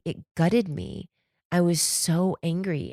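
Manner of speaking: ordinary talk, nothing marked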